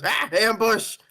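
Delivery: high, comic yelp of alarm; startled cry. Acted. Player's character spots a griffin swooping down, high startled yelp. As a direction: cry out high as if suddenly ambushed.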